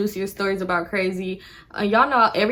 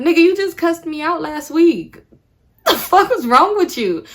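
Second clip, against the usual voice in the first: much higher and louder, outraged; exasperated shout; 9 semitones higher, 7 dB louder than usual. Retold or imitated. Yelling at the guy who cussed her out. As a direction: shout it at him in disbelief.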